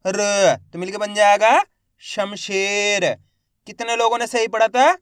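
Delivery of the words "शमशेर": शमशेर drawn out slowly again; slow blended reading. Shown as a demonstration. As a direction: read शमशेर slowly, teacher style. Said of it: "एक बार फिर से देखिए". (शमशेर धीरे धीरे)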